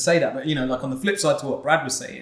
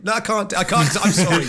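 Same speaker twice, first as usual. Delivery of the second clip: ordinary talk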